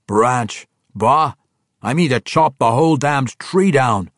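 dwarf voice. Acted. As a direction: boom it out like a proud dwarf king. Brunner scoffs, declares boldly.

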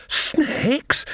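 high, voiceless wheeze of disbelief; wheezing. A narrator's reading. Old witch winded from running, wheezing in disbelief. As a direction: squeeze it out high on failing breath. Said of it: "Nanny wheezed".

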